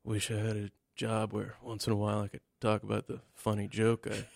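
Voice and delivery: low, hushed funeral-director thoughts; serious voice. Acted. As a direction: play the bored undertaker in a solemn voice. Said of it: "We're gonna use the serious voice".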